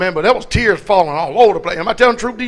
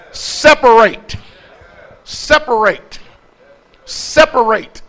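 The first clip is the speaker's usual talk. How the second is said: each Separate slow and heavy, falling; repeated hammered word; 3 dB louder, slower than usual. Acted. Preacher to congregation, pounding Separate. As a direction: drive the word home, one at a time.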